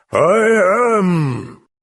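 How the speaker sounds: sudden high, loud demon bellow; a demon bellowing to prove it is the devil. A narrator's reading. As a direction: roar it out in fury. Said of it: "Reagan bellowed".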